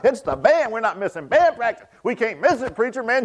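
high, sing-song mimic of fanatics; mocking band parents. Preacher teasing the congregation about band parents, high mock. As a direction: poke fun at band obsession. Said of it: "mocking band parents' devotion".

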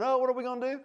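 a mild, put-on cry of alarm; mock panicked cry. Preacher voices Israelites stuck at the sea, mock panicked cry. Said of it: "and they're screaming".

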